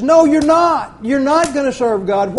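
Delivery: a firm rebuke from Joshua; stern Joshua. Retold or imitated. Joshua flatly refusing the people's promise, firm. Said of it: "and Joshua says".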